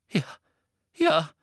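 breathless, high little yes; gasping. A narrator's reading. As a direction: gasp out the admission. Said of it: "keuchte Hermine".

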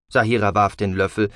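plain reading voice

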